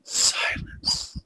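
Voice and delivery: hushed whisper for effect. Retold or imitated. Teller marking the stunned table, whispered. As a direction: drop to a drawn-out whisper.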